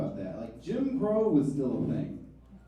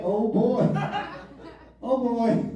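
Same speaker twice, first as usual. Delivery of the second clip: put-on a touch of a simple-minded repeated "Oh boy"; lightly voiced oh boy character; lightly mimicked oh boy; 6 semitones higher, slower than usual. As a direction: say it like it's your only words. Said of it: "he can only say".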